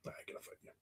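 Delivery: breathy whisper, voice dropped right away; whispered aside. Real, not acted. Co-host whispers his retreat after offering a fight, whispering. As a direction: back down in a sneaky whisper.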